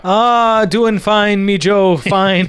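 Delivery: put-on a deliberately terrible Irish accent that slips toward Scottish; bad Irish accent. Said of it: "that's a terrible Irish accent".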